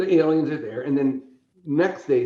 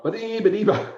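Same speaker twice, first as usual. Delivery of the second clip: alien babble; 9 semitones higher, 4 dB louder than usual. Retold or imitated. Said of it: "they all look and go".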